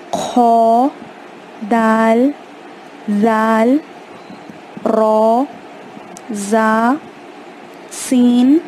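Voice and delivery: each Arabic letter name said clearly, with a pause after it; slow classroom letter drill; letters recited one by one. Shown as a demonstration. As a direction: spell out الف، با، تا with gaps for students to repeat. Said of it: "میرے بعد پڑھیں".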